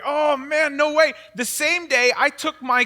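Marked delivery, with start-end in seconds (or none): 0.1-1.1 mock dismay. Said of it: "It's like".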